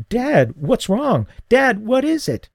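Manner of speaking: high, frightened cry of dad, slowing on the first words; a scared kid watching his father choke; panicked son. Acted. Son sees his dad choking, calls out in high fright.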